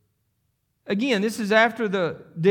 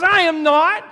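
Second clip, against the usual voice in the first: higher and louder, flat refusal; loud denial; 10 semitones higher, 9 dB louder, slower than usual. Acted. Peter denying the accuser, loud defiant refusal. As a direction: snap back a defiant denial.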